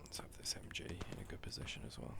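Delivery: soft unvoiced whisper. Real, not acted. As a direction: whisper it to yourself. Whispering while playing.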